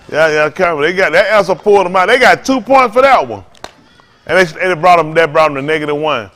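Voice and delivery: normal talking voice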